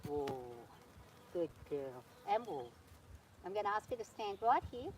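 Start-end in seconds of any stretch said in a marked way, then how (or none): 0.1-2.7 soothing whoa to horse. Real, not acted. Calming horse to halt.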